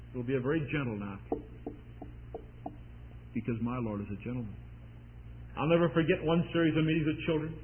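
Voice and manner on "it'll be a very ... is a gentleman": gentle. Acted. Gentle knock contrasted with pounding.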